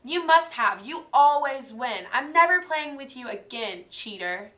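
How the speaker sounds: loud, furious accusing shout; angry shout. Acted. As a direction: shout at her best friend. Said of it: "Gail shouted".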